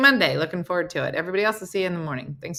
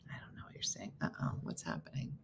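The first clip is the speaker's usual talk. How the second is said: hushed, confused whisper to herself; whispered puzzlement; 12 semitones lower, 20 dB quieter, faster than usual. Real, not acted. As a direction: whisper as if not to be overheard. Whispered reaction to something on screen.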